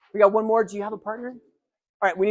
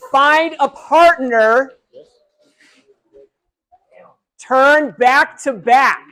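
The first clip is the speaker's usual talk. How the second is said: slow commands; 3 semitones higher, 6 dB louder, much slower than usual. Real, not acted. Instructing a big room.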